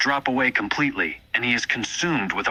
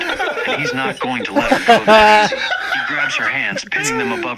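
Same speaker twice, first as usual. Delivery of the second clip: high, laughing, slowed reading; laughing through words; 14 semitones higher, 6 dB louder than usual. Real, not acted. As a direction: read it while cracking up. AI narration read while laughing.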